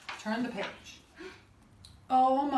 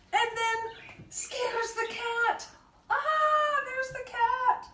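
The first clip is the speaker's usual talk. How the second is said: high, drawn-out storytime excitement; high-pitched, hammed-up panic; mock alarm; 12 semitones higher, slower than usual. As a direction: squeal in pretend shock for small children. Flea wakes animals, mock alarm.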